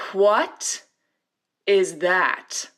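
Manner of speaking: snooty housewife. Retold or imitated. Real Housewife sneering at the class bunny, disdainful. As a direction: look down your nose at it. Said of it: "like the Real Housewives of Orange County".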